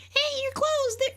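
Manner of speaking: put-on very high, bright-pitched girl voice; high girl voice as the game's ranch girl. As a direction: play a young farm girl, squeaky high. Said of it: "I gotta give the girls a different voice".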